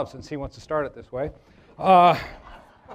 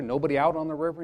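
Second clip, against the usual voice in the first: ordinary talk, nothing marked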